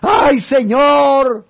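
long, high, loud drawn-out wail; mock wailing cry. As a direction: stretch it out like a lament to the Lord. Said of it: "ahí sí empezamos a llorar".

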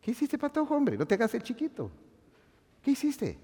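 put-on high, hushed scolding of a child; a hint of a parent scolding a boy; scolding a kid. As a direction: chide a kid caught out, lightly voiced.

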